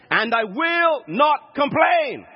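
high, slow, word-by-word resolve; emphatic declaration. Shown as a demonstration. Preacher modelling a resolve about the food, slow emphatic shout. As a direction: proclaim it slowly, punching each word. Climax of his example resolution.

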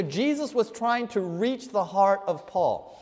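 ordinary talk, nothing marked

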